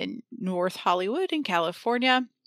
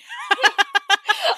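high laugh; 13 semitones higher, 5 dB louder than usual. Real, not acted. Bursts out laughing.

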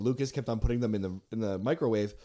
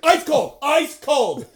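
much higher, loud squeal; mock disgust shout; 14 semitones higher, 9 dB louder, slower than usual. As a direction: shriek that it's cold. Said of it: "I was like".

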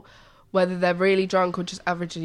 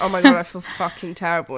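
hungover groan; 7 dB louder than usual. Retold or imitated. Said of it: "I'm like".

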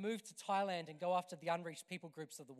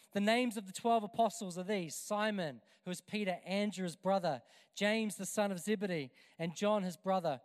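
loud scripture reading; 6 dB louder than usual. Acted. Reading the apostles' names loudly.

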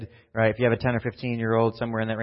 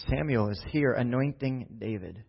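ordinary delivery

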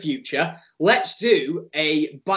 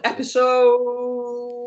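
drawn-out announcement; 6 semitones higher, much slower than usual. Acted. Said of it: "drawn out announcer intro".

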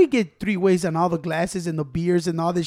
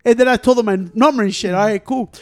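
ordinary delivery

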